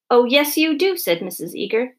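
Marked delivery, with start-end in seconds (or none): 0.1-1.0 higher, louder, insistent retort; angry contradiction. A narrator's reading. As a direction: snap back at the denial. Angry mother contradicts on phone.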